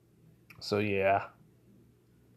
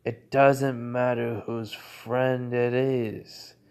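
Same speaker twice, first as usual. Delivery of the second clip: slow firm reply; much slower than usual. Acted. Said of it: "He goes".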